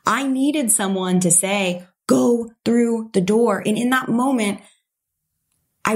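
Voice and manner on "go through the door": slow, pointed command; solemn permission. Retold or imitated. The permission she had been waiting for, slow and solemn. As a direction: say it like a message from fate.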